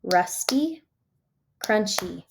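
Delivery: "rusty", "crunchy" set apart, each in two beats; clapped-out syllables. Shown as a demonstration. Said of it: "I like to clap 'em out".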